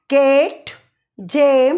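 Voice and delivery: केट and जेम with a clear ay glide; ay vowel. Shown as a demonstration. Teacher modelling the ay vowel for students. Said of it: "थोड़ा सा आई जैसा sound".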